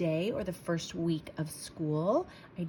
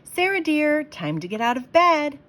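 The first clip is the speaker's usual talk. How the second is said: higher, louder cheerful dad calling her; father's voice; 9 semitones higher, 7 dB louder than usual. Acted. Dad waking Sarah for school, cheerful call.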